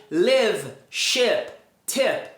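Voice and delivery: "live, ship, Tip" with a short closed vowel near ê; short lax I in "live, ship, Tip"; correct short vowel. Shown as a demonstration. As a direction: say "live, ship, Tip" as lehv, shehp, tehp. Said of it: "Essas palavras são pronunciadas".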